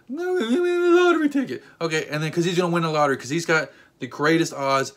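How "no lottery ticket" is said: high squeaky mock amazement; mock surprised rescuer. Rescuers pulling a lottery ticket from turtle's nose, squeaky mock amazement. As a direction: ham up a rescuer's astonished squeal.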